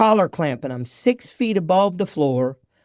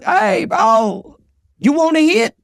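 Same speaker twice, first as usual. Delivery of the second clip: imitating coworker; 10 semitones higher, slower than usual. Drunk coworker offering crack.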